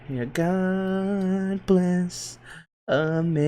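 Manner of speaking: very slow, drawn-out solemn God bless; mock patriotic. Streamer gloating over the euro in mock patriotism. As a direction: draw it out like a fake anthem. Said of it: "No, I'm kidding".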